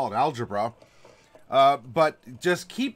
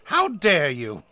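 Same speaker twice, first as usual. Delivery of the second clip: mock outrage; 6 dB louder, much slower than usual.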